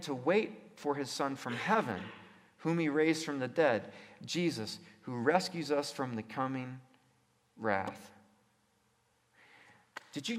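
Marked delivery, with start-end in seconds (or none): none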